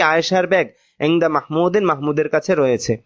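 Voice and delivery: ordinary talk, nothing marked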